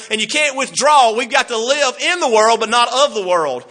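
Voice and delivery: rising preacher's fervor; preaching at full force. Acted. Preaching climax on living in world.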